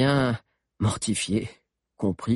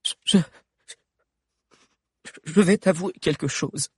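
hesitant confession; 10 semitones higher, 4 dB louder than usual. Acted. Valère stammers confession.

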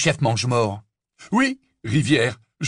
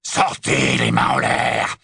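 much lower, slow rasping command; hoarse voice; 14 semitones lower, 3 dB louder, slower than usual. Acted. Snatcher ordering the hidden friends out, low rasping command. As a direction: growl the order out of the dark. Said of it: "une voix rauque".

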